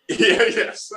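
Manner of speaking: laughing yes. Real, not acted. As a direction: laugh while agreeing. Laughing at tailpipe joke.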